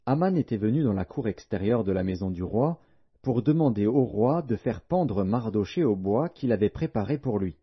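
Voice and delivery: ordinary delivery, nothing marked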